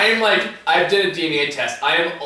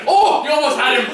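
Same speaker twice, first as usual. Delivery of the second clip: put-on higher excited Irish-accent yells, laughing; Irish kid cheering; 10 semitones higher, 4 dB louder than usual. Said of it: "he just goes".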